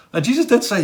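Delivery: usual speaking voice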